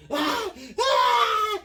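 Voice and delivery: high loud pained scream; hammed-up scream of pain; mock yelp. Naked boy screaming as the belt lands, loud and high.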